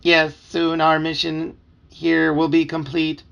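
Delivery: put-on slow, loud, level-pitched mind-controlled boy; flat voice. A narrator's reading. Narrator voices a blank-eyed boy reporting on the mission, flat drone. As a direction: drone it slowly on one note. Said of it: "say in his flat voice".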